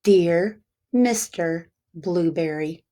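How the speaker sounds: ordinary delivery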